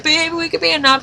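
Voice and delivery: quick, firm statement, "be" stressed, falling at the end; adamant. Retold or imitated. Fan contrasting Harry's adamant line, firm and falling. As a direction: say it flatly certain, no question in it. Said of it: "Instead of being as adamantly".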